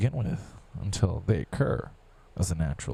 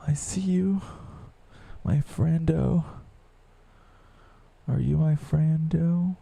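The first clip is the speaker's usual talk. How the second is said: taunting; 8 semitones higher than usual. Pretend. Teasing the listener, slow sly taunt.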